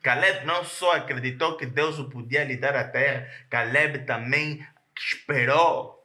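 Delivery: normal talking voice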